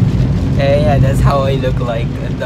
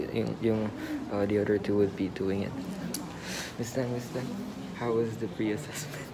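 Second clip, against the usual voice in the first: muffled by surgical mask; speaking behind mask; through a face mask; 7 semitones lower, 15 dB quieter than usual. Real, not acted. Seen 0: Speaker wears blue surgical mask over mouth throughout labelled words.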